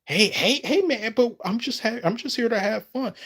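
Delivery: high mock-pleading protest. Answering a lobby insult, high and pleading. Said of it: "And I was all like".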